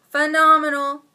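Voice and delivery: loud gushing phenomenal; excited. Real, not acted. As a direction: shout phenomenal with delight. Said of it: "repeats word".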